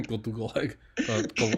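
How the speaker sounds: lightly imitated Russian accent; playful echo in a Russian accent; mock Russian accent. Friend joining in the gag, mock Russian accent.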